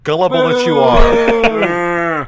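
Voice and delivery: mock scolding. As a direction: drag it out like a stern scold.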